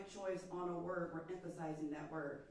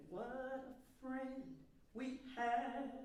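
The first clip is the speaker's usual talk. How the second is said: slow, higher, drawn-out "What a friend we have"; deliberate, lifted "What a friend we have"; emphatic spacing; 6 semitones higher, slower than usual. Shown as a demonstration. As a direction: stretch "friend" and "have" with space around them. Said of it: "emphasize it with space".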